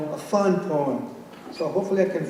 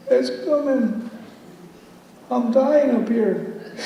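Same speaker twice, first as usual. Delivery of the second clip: mock exasperation; 9 semitones higher, much slower than usual. Comic strain waiting for page.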